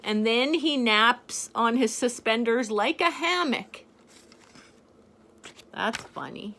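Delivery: usual speaking voice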